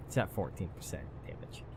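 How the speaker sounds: whispered aside. Real, not acted. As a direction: whisper it under your breath.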